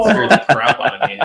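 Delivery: giggling through the words; laughing. Real, not acted. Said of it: "That's not bad".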